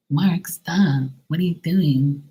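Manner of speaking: high mock of the neighbor scolding; neighbor's annoying voice. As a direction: ham up her shrill complaint. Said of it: "That's what she sounds like".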